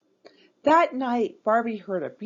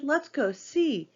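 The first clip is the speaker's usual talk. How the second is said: eager shout; 7 semitones higher than usual. A narrator's reading. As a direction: call them along, pitched up. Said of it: "Chelsea shouted".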